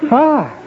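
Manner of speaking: high, acted "ah" of shock; astonished gasp. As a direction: gasp as if suddenly finding danger beside you. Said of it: "with great astonishment you go".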